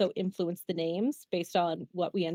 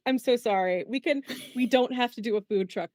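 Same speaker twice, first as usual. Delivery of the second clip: normal talking voice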